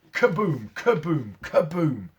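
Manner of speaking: loud dramatic kaboom; explosion booms. A narrator's reading. Narrator voices the bombs falling on London, loud booms. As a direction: boom it out like a bomb.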